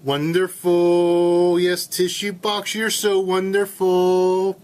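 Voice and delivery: slow, drawn-out, rising to a loud high swoon; hammed-up lovesick crooning to a tissue box; over-the-top mock serenade; mock swooning lover.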